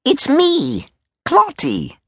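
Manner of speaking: high-pitched eager soldier voice; soldier Clot. Acted. Soldier announces himself to the Major, high and eager.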